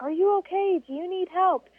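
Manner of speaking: high, caring voice of a stranger asking; concerned stranger. Retold or imitated. The truck crew asking her, concerned and high. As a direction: raise the pitch and ask with concern.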